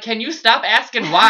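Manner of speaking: loud, high, half-laughing protest; exasperated outburst. Retold or imitated. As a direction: act out losing patience with the questions. Retold exasperation at office staff.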